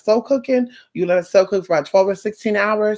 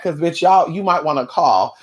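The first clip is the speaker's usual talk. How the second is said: ordinary talk, nothing marked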